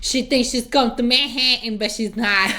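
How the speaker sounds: Manhattan said loud with its t's swallowed; mimicked Manhattan pronunciation. Viewer imitating her best line, Manhattan with swallowed t's. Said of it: "her saying Manhattan".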